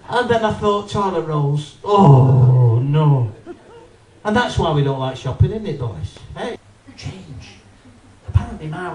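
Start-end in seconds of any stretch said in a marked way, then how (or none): 1.8-3.3 mock dread. Dreading toilet roll sound.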